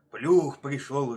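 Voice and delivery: loud, slow, drawn-out roar; heavy bear bellow. A narrator's reading. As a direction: bellow it with long vowels. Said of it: "ревёт медведь".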